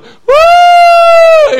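high piercing whoop, very loud; retold joyful shout. Preacher imitating a crying man at the altar, high loud whoop. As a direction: whoop it out like a man overcome in church. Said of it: "he was going".

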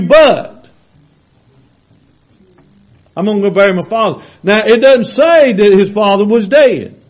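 full-force preaching. Acted. Preacher to his church, preaching at full force. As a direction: drive the scripture home to the congregation.

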